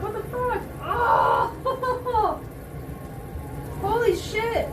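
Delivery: real disbelief. Real, not acted. Eyes streaming, makeup ruined.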